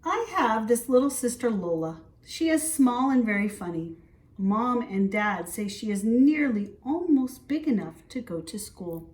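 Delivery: bright, lively read-aloud voice; story reading to children. A narrator's reading. Teacher reading a picture book to preschoolers, lively. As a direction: read it out to the little ones with energy.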